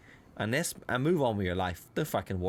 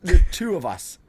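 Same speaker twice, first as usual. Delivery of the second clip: incredulous retort; 4 semitones higher, 3 dB louder than usual. Retold or imitated. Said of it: "I was like".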